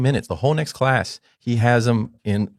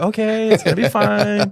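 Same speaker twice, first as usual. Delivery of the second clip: high, sing-out fake reassurance; strained, put-on parental calm; forced cheerful composure; 12 semitones higher, 3 dB louder than usual. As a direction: pretend all is fine right after exploding. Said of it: "coming back in the room like".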